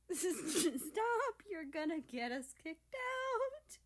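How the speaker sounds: high, laughing protest; giggling plea. Acted. Japan giggling at America in the cafe. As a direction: try to stop laughing and fail. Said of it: "between giggles".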